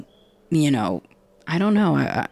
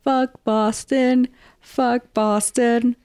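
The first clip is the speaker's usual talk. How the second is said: higher, slow, drawn-out mock yell; hammed-up chant against Boston; stretched-out mock shout; mock screaming; 7 semitones higher, much slower than usual. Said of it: "he's screaming".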